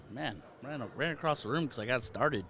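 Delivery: breathless and winded, talking through panting; out of breath. Real, not acted. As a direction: catch your breath between words. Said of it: "Now I'm all out of breath".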